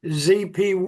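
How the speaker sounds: ordinary delivery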